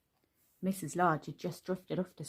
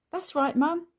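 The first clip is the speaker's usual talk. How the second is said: put-on much higher, louder little boy voice; eager small boy; 9 semitones higher, 7 dB louder than usual. A narrator's reading. Luke backing up his brother, high eager boy. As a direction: chime in keenly. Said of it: "he agreed".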